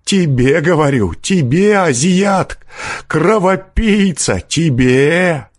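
high-pitched insistent accusation; fierce cursing. A narrator's reading. Peasant to forester who turned round, fierce accusation. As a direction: hurl insults at the man who turns round. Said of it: "подхватил мужик свирепым голосом".

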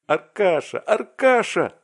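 high, loud cry of a friend's name; acted outcry, building in volume; excited shout. A narrator's reading. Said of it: "закричал Вася".

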